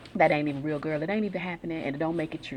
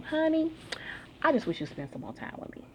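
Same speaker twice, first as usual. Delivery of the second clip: lightly voiced wife, high on honey; a hint of a pleading wife, honey lifted high; wife's sweet plea; 11 semitones higher, 3 dB quieter than usual. Wife asks husband for time.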